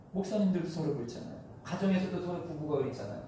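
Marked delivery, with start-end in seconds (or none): none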